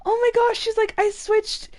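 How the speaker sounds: high, eager imitation of a thrilled friend; excited friend. Coworker's wife excitedly tells her about the switched recipe. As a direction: squeal it like you can't wait to share. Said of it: "she got really excited".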